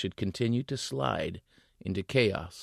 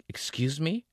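startled; 8 semitones higher than usual. Acted. She panics.

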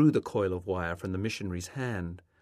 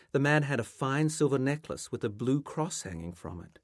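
ordinary delivery

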